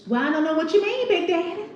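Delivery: high coy voice; mock-innocent reply in a high voice; character voice. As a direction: play innocent in a high put-on voice. Voicing a character again.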